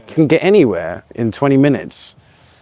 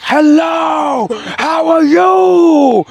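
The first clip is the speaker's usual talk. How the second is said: much higher, slowed, drawn-out bellow; parodying his own MC voice with a big stretched shout; mock ring announcer shout; 14 semitones higher, 3 dB louder, much slower than usual. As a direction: boom it out like he's in the ring. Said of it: "I don't speak like that".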